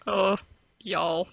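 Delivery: a token stab at a Southern drawl; Southern accent. Pretend. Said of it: "you can do a southern accent".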